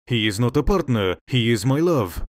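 ordinary delivery, nothing marked

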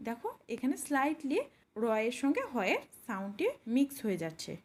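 ordinary talk, nothing marked

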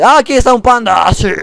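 high, loud delighted cry; a gamer shrieking over a rare find; excited discovery. Real, not acted. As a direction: yell it like you just found treasure. Spots a panda in game.

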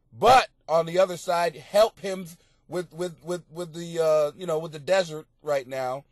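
ordinary delivery

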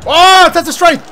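shocked outcry. Real, not acted. Overpowered by opponent in game.

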